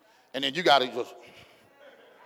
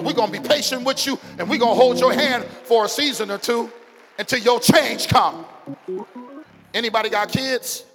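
preaching that climbs louder and higher to a shout; building sermon; 6 semitones higher, 7 dB louder than usual. Acted. Pastor rallies the congregation, building to a shout.

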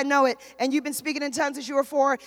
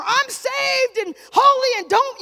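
high, loud, drawn-out parody of piety; mock proclamation; 9 semitones higher, 7 dB louder, slower than usual. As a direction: blare it like a showy banner, hamming it up. Mocks a showy banner of faith.

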